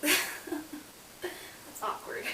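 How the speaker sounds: laughing it off, slowed right down; an embarrassed giggle through the words; awkward laugh. Real, not acted. Vlogger laughing off the neighbour banging on the wall, awkward chuckle.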